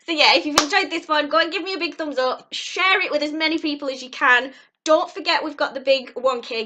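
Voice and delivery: bright YouTuber outro; vlog outro. Acted. Closing the vlog for subscribers, upbeat outro voice.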